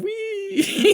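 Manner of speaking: high gleeful squeal; mock squeal. Dangling man's little legs squealing in mock glee. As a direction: squeal like a kid on a swing.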